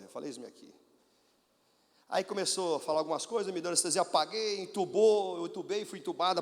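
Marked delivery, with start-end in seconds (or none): 0.3-0.7 baixinho e mais grave, em rendição; entrega humilde. Real, not acted. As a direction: diz "Eis-me aqui" como oração baixa. Entrega humilde antes da cirurgia. (oração baixa de entrega)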